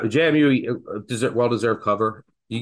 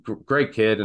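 usual speaking voice

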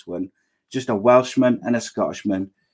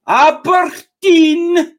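loud, high, drawn-out mock Scottish shout; bad Aberdeen accent; 8 dB louder, much slower than usual. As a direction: belt it out long in a hammed-up Scottish accent. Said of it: "Kev loves my Aberdeen accent".

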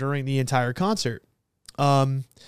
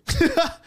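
short high burst of laughter; real laugh; 11 semitones higher, 3 dB louder than usual. Real, not acted. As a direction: laugh in disbelief. Incredulous about her reaction.

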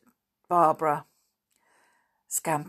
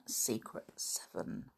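breathy whisper of the title, much softer; hushed title; 7 semitones lower, 14 dB quieter than usual. Acted. Whispers the book title to the listening children. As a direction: whisper the name like a secret.

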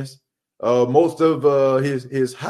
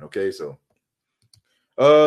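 ordinary delivery

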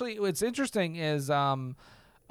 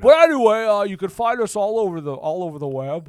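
Jimmy Stewart; 2 semitones higher, 8 dB louder than usual. Pretend.

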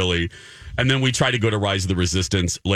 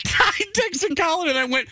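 frantic reenactment. Retold or imitated. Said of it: "And this was me".

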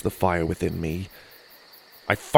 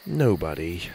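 sadly; 4 semitones lower, 4 dB quieter than usual. A narrator's reading. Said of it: "I said sadly".

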